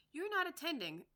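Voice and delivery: high, loud, stern mouse; severe. Acted. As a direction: scold her sharply. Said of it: "said the mouse to Alice severely".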